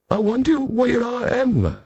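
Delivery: a hint of a lost narrator wondering; wondering aloud. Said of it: "I wondered out loud".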